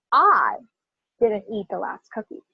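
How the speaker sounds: "I" stretched and set apart, then pause; stress on I. Shown as a demonstration. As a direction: lean hard on "I", drop off for the rest. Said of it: "I'm gonna emphasize each word".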